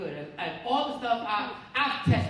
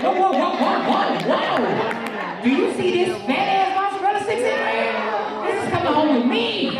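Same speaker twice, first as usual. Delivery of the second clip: loud, high mock yell over the mozzarella stick; protective shout; 4 semitones higher, 6 dB louder than usual. Comic yells at the waiter, loud high mock shout. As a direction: shout to stop the waiter taking the plate.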